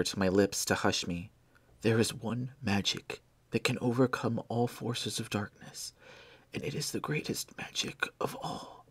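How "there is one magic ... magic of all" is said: soft urgent whisper; dying whisper. Acted. Dying Blair to Benjamin, a weak urgent whisper. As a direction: press him to go, barely voiced.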